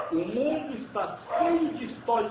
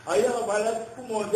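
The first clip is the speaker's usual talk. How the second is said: ordinary talk, nothing marked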